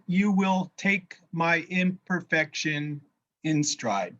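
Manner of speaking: ordinary talk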